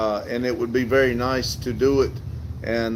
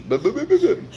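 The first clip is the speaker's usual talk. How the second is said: silly high sputter; 12 semitones higher, faster than usual. Real, not acted. Silly sputter after thanks.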